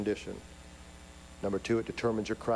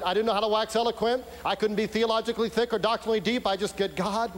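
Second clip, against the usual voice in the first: loud, high-pitched pulpit delivery; impassioned preaching; 8 semitones higher, 4 dB louder than usual. Real, not acted. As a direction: drive it home to the congregation at full voice. Preaches at full force.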